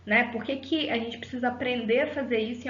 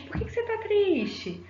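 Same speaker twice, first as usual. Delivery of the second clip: a much higher, lightly acted caring question; imitating a concerned question; 10 semitones higher than usual. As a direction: play someone asking a crier why, pitched well up. People reacting to someone crying. (imitando uma pergunta preocupada, bem mais aguda)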